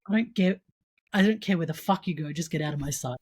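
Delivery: ordinary delivery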